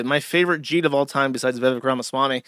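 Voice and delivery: usual speaking voice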